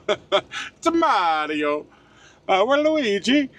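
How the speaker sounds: put-on hammy Mario-style Italian accent, high and slow. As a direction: play up a cartoon Italian accent. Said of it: "me and my dumbass Italian accent".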